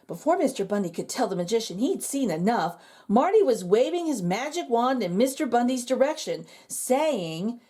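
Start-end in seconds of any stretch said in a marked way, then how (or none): none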